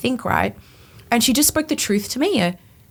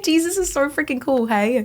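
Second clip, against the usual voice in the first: high, emotional burst, almost crying; overcome, voice lifting high with joy; moved to tears; 6 semitones higher than usual. Real, not acted. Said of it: "I almost cry".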